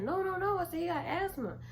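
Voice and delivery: high, pleading no; urgent pleading. Retold or imitated. Pleading with her brother for her friend, high. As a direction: beg him to stop.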